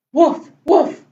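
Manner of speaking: short, loud mock woofs; two punchy spoken woofs; puppy bark. Story reader barks as puppy Biscuit.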